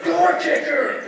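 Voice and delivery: high, loud accusing yell; having a breakdown. Acted. Husband names the unknown burglar, hysterical yell. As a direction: yell the nickname like a man unhinged. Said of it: "He starts having a breakdown".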